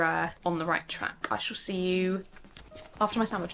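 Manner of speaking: soft unvoiced whisper. Real, not acted. To viewers before her sandwich, whispered. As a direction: breathe it out like a secret.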